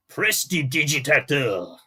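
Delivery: slow, showy French Gallic accent; hammed-up French Gallic accent, showing off his favourite word. Shown as a demonstration. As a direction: savour each syllable in a proud French Gallic accent. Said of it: "my true French Gallic accent".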